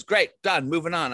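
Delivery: quick mock director's sign-off; brisk director. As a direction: call the wrap like a director. Imitates director wrapping after one take.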